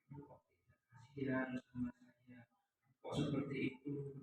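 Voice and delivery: very soft, imploring demo; mock pleading. Teacher posing as one who makes a child feel guilty, pleading. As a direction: plead softly, almost under the breath. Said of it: "itu nadanya memelas".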